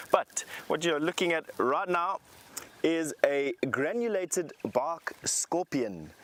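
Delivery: ordinary talk, nothing marked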